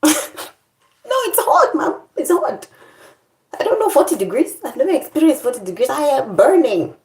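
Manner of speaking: whining to Mom about the heat; whining complaint. Retold or imitated. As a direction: whine like a hot kid.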